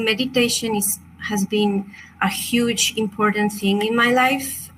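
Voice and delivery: ordinary delivery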